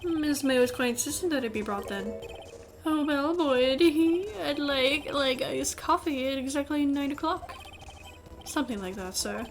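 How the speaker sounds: fussy English bellboy testimony; horrible English accent. Acted. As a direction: ham up a servant's English accent. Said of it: "saying it in this horrible English accent".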